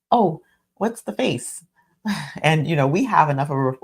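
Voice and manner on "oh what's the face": casual teasing. Retold or imitated. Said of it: "I could say it exactly like that".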